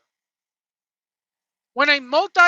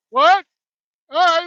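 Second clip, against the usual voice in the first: normal talking voice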